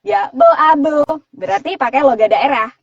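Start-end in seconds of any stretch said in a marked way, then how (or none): 0.1-1.0 Madurese character, higher and louder; Madurese accent. Shown as a demonstration. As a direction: put on a punchy Madurese accent. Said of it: "karakter Bubariah misalnya dari, dari Madura".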